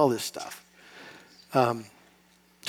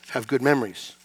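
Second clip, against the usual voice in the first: normal talking voice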